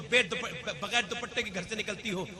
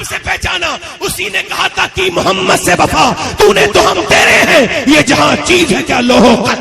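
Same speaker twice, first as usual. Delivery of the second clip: full-force preaching; 8 semitones higher, 19 dB louder than usual. Real, not acted. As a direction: build to a very loud, high shouted climax. (جوش میں گرجتی ہوئی بلند خطابت)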